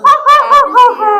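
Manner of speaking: high hammed-up ha ha laugh; lion's mocking laugh. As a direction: laugh it off like a scornful lion. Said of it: "The lion laughed".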